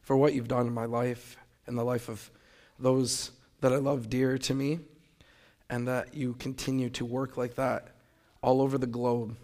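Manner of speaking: soft, hushed prayer voice. Acted. Speaker opening his testimony with a quiet, humble prayer. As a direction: pray quietly and humbly to God.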